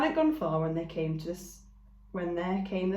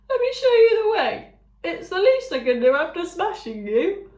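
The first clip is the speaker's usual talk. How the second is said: friendly eager ram; Thud the ram; 12 semitones higher, 10 dB louder than usual. A narrator's reading. Ram introducing himself to the robot, eager. Ram's voice.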